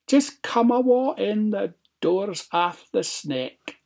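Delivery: high-voiced granny, easing down at the end; old granny. A narrator's reading. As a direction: call from bed as a high-pitched granny. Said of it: "Granny said".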